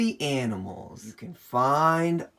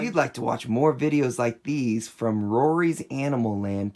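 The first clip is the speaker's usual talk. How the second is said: ordinary talk, nothing marked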